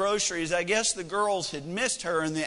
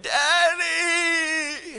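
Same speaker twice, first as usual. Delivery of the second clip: high, drawn-out mock wail; tearful little girl; 9 semitones higher, 4 dB louder than usual. Crying daughter runs to dad, high imitated wail. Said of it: "with tears streaming down her face".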